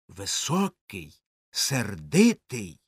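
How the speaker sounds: plain reading voice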